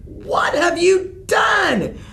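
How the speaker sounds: high, loud, slow furious shout; mother yelling at her son. Acted. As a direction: hammer home the broken deal. Mother furious at undone deal.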